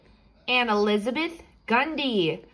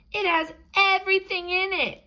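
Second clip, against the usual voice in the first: mother voice; 11 semitones higher, faster than usual. Acted.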